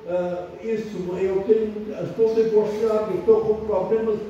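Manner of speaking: ordinary talk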